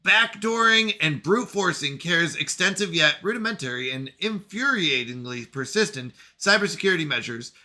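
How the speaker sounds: measured narrator reading event text; story narration. Acted. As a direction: read it like a sci-fi log entry. Reads game event text.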